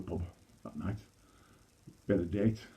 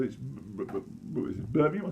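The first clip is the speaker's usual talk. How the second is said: stammering; 6 dB quieter than usual. Acted. Stammering kid guesses.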